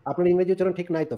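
normal talking voice

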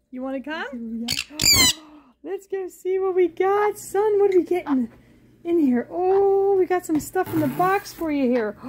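ordinary delivery

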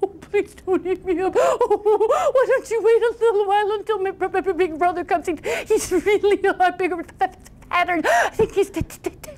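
high, quavering, stuttering begging; pleading goat. Acted. Second goat begging the troll to wait, scared high stutter. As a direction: stammer out a frightened plea in a high voice.

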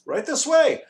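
very high, loud summons; calling out. A narrator's reading. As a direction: sing out to lead them on. Guide calling the group onward.

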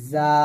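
জা with a whistling z and held thin a; buzzing Arabic za. Shown as a demonstration. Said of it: "এরকম". (জা পাতলা করে টেনে)

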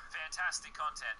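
ordinary talk

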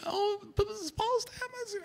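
high-pitched, hushed mock excitement; imitated excited child. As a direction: mimic a child telling the parents excitedly. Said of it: "she was really enthused".

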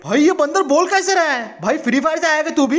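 high-pitched disbelief; startled, incredulous questioning; astonished. Acted. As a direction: blurt it out high, amazed. Astonished that a monkey is talking.

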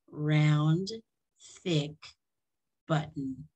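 ordinary delivery

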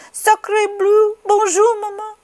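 high little French duckling; baby duck. A narrator's reading. Newly hatched duck greets Jack.

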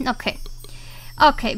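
normal talking voice

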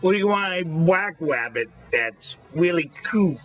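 high, teasing wabbit talk; mocking the girl's lisp. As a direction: play along with the kid's lisp, sing-song. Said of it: "He goes".

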